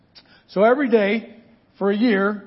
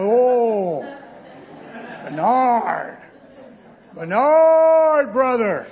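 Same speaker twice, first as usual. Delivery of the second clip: mock warning; 3 semitones higher, 3 dB louder, much slower than usual. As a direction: tease a friend with a knowing warning. Mock warning to brother Bernard.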